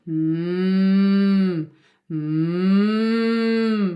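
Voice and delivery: imitated long mooing "mm"; retold singer's cow-like humming; cow-like hum. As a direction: hum a held note like a cow. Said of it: "You sound like a cow".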